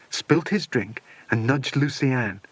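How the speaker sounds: plain reading voice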